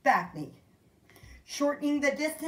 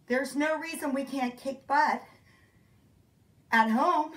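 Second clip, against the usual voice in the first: usual speaking voice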